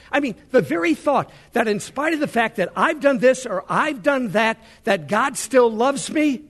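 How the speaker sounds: exultant preacher driving the point home; full-force preaching. Acted. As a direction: preach it with mounting passion.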